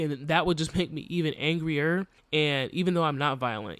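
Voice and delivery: ordinary delivery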